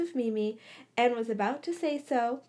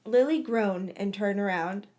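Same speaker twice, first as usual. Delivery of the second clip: usual speaking voice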